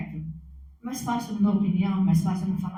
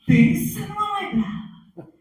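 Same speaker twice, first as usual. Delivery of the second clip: exclaimed; 12 semitones higher, 8 dB louder, slower than usual. Real, not acted. Describing angry mother.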